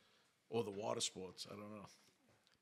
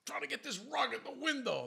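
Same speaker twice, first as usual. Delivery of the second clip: neighbour's reply; 5 dB louder than usual. Retold or imitated. Neighbour answers.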